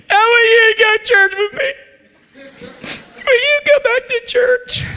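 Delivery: high, loud, tearful cry; crying plea. Retold or imitated. Drunk preacher tearfully pleading to go back to church. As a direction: beg through the tears. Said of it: "but I was crying".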